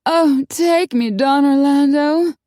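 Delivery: high, loud, slow breathless swoon; swooning moan. A narrator's reading. Corky begging her lover, high loud swooning moan. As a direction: melodramatic plea of passion. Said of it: "Corky moaned".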